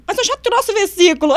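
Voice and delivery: high, loud insistence; insistent colleague. Retold or imitated. Colleague demanding the sermon, high loud insistence. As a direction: press the point high and loud.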